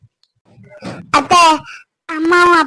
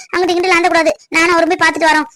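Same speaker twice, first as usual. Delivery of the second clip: ordinary talk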